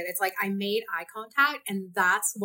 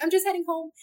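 retold polite reply; 9 semitones higher, faster than usual. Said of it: "but I was like".

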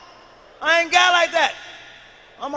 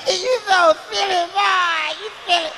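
laughing; 6 semitones higher, 6 dB louder than usual. Real, not acted. Laughing at comic.

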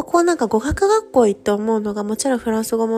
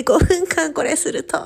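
higher, slower, giggling words; laughing; 8 semitones higher than usual. Retold or imitated. Mimicking boyfriend holding the pose, laughing. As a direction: laugh through the boyfriend's line. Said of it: "みたいな".

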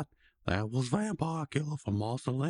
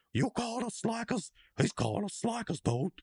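mock indignant slackers; 10 semitones higher than usual. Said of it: "they're all like".

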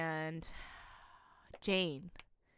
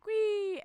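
high strained squeak; 12 semitones higher than usual. Retold or imitated. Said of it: "I'm just like".

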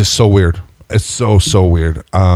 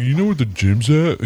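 higher-pitched comic impression; Chris Farley; 6 semitones higher, 4 dB quieter than usual. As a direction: ask it like a bumbling comedian. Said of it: "like Chris Farley".

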